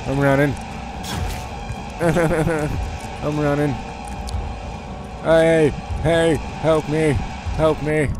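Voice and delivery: high frantic cries for help; panicked. Real, not acted. Panicked escape from monster.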